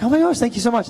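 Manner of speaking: high, loud imitation of a thrilled fan; gushing fan. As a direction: gush like a starstruck fan. Excited fans thanking him.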